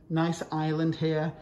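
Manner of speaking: ordinary talk, nothing marked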